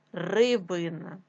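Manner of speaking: normal talking voice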